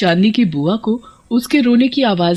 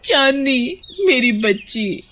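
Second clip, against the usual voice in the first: crying; 5 semitones higher than usual. Acted. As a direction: sob out the plea, grief put on for show. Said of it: "चांदनी की बुआ रोने लगती है".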